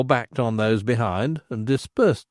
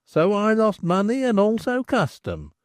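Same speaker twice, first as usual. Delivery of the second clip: lightly raised, sing-song reading of a tavern sign; rhymed notice read aloud; 5 semitones higher than usual. A narrator's reading. Narrator reading the landlord's notice in a sing-song way. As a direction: recite the verse like a posted notice.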